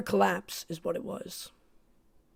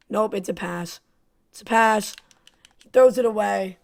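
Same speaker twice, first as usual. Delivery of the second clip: ordinary talk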